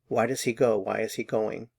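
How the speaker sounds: normal talking voice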